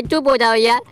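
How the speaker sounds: ordinary talk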